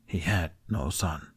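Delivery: slow, soft inner resolve. A narrator's reading. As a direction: say it again as a private vow. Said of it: "repeated inwardly".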